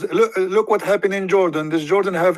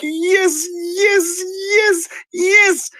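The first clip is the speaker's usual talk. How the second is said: mock excited; much slower than usual. The follower answering the Prophet, giddy high squeal. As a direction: gush the yeses in giddy mock joy. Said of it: "get so excited".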